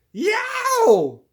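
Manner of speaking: very high, loud pained cry; pained yelp. A narrator's reading. Pig cries out as turtle bites tail. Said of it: "says the small pig".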